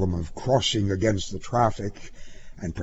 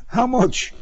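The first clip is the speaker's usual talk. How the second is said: high, tentative voice; meek high query; timid question; 13 semitones higher than usual. A narrator's reading. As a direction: ask it nervously, without force. Timid driver asks about money.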